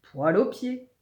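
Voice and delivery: put-on heckler's cry; mild yell. A narrator's reading. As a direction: call out the rhyme teasingly. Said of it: "hurle Zekeye".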